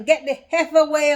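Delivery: high, loud mock yell at the homeless man; retold yell. Bus stop bystander shooing the homeless man, loud mock yell. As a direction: bark it like an annoyed bystander. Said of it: "Some guys were there was yelling".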